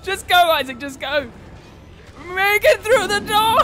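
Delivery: high, loud shrieking with laughter; panicked scream. Real, not acted. Yelling at Isaac to escape, panicked. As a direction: scream while running for the door.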